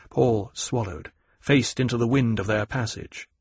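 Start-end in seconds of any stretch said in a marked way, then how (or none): none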